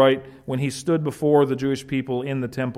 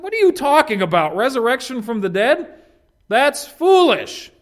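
mocking outburst; 9 semitones higher, 6 dB louder than usual. Festus scoffing loudly at talk of resurrection. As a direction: scoff at the idea like a skeptic.

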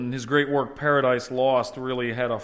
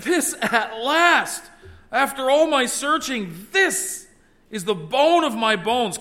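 high, loud, slow exclaiming; emphatic exclamation; 9 semitones higher, 6 dB louder, slower than usual. Acted. Adam exclaiming with joy over his new wife. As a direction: proclaim it with overflowing joy. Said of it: "he uses this very emphatic statement".

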